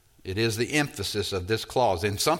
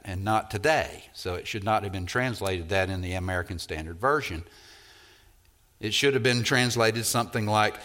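normal talking voice

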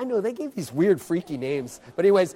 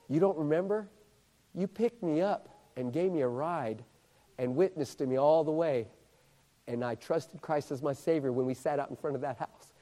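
low, soft, intimate reminding; hushed recollection; 7 semitones lower, 11 dB quieter than usual. Retold or imitated. Ray Ray reminds the preacher of the ride, hushed and low. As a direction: let it out quietly, well below preaching volume.

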